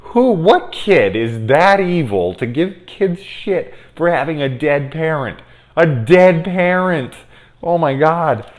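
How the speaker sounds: high, slow, indignant; outraged. Real, not acted. Ranting about cruel kids, high indignation. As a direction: stretch out the outrage.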